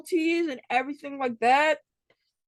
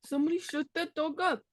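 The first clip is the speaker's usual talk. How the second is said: higher, softer mock British accent; 5 semitones higher, 5 dB quieter than usual. Host copies the guest's complaint about the dog, British accent. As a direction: copy the line in a pitched-up British voice. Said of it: "That was my British accent".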